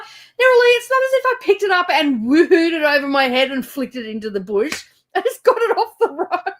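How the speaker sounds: high, loud mock-offended protest; indignant retort. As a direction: protest loudly that you did nothing wrong, half laughing. Defending herself to her daughter.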